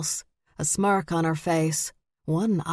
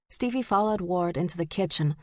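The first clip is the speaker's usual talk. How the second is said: plain reading voice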